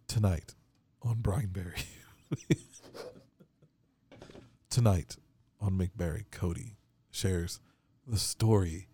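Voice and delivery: slow trailer voice sinking to a whisper; spooky announcer. Pretend. Introducing Cody's ghost story as a spooky TV host, ending in a whisper. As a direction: tease the episode like a horror trailer.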